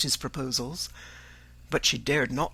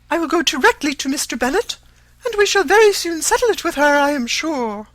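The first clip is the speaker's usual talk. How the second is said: high, loud and fast; hurried matron; 13 semitones higher, 10 dB louder than usual. A narrator's reading. To Mr Collins, a fast, flustered high promise. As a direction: rush the words out in a flurry.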